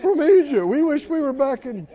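high, loud mock complaint; complaining. Preacher voices Israelites wishing for Egypt, complaining. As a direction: protest like ungrateful grumblers. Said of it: "And then they go".

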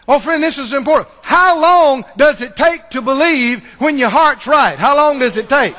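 loud, soaring proclamation; loud triumphant preaching; preaching at full force. Acted. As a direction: thunder it out to the congregation.

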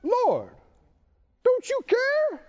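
high-pitched, worked-up complaint; Martha. Acted. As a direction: protest in a high, exasperated plea. Acts out Martha's upset interruption.

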